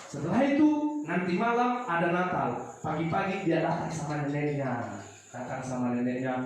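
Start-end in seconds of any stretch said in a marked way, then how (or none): none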